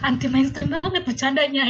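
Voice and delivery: brushing off the news as a joke, with a laugh; laughing disbelief. Retold or imitated. Retelling how she laughed off her fiancé's breakup news, loud and high in disbelief.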